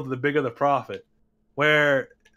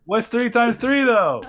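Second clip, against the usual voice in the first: squeaky, much higher and louder question; mock reporter question; 9 semitones higher, 6 dB louder than usual. Reporter grilling Trump, much higher and louder. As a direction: pipe it up like a pushy reporter. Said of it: "It's like".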